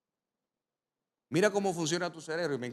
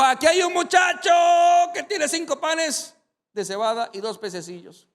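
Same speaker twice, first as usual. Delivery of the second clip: high, loud excited call; Andrés eagerly announcing his find; eager shout; 12 semitones higher, 11 dB louder than usual. Retold or imitated. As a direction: call out eagerly about the boy. Andrés calling out to Jesus.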